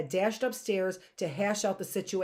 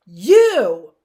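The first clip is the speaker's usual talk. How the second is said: loud accusing cry; 17 dB louder than usual. A narrator's reading. Violet confronts Stefano, loud accusing cry. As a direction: burst out the word, much louder. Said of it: "Violet began to say".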